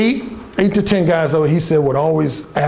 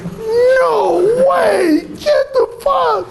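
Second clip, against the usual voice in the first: high, stretched-out mock yell; incredulous brother; much slower than usual. Brother learning of the pickup, hammed-up disbelief. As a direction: imitate a squealed, can't-believe-it reaction. Brother's stunned reaction, acted out.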